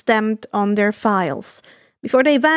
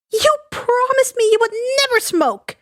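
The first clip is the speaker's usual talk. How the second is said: high, hurt maternal scold; 11 semitones higher than usual. Acted. Inko scolding Giran for smoking, high hurt scold. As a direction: remind him of the broken promise, high. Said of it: "Inko scolded".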